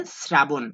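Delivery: শ্রাবণ said with a dental s, srabon; শ্রাবণ as srabon, not shrabon; teacher models শ্রাবণ with an s sound; correct pronunciation. Shown as a demonstration. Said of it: "শ্রাবণের উচ্চারণ তালব্য শ দ্বারা হবে না, দন্ত স দ্বারা হবে".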